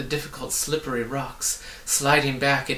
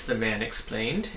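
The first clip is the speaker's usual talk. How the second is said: plain reading voice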